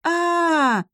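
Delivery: очень высокий протяжный визг; визжит, падая вниз; визг при падении. A narrator's reading. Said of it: "завизжал посол". (визг падения)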